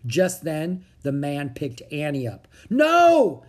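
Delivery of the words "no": high, loud cry of refusal; shouted protest. A narrator's reading. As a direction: shout in alarm as you are grabbed. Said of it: "shouted Annie".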